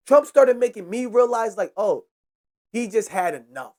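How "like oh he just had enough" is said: ordinary delivery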